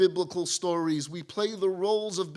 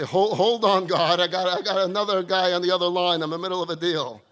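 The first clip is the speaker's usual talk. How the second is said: hurried brush-off; 5 dB louder, faster than usual. Acted. Busy caller putting God on hold.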